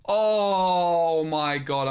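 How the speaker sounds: very slow, loud, drawn-out groan of exasperation; groaning outburst. Real, not acted. Streamer groaning at losing the game. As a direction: drag it out in despair.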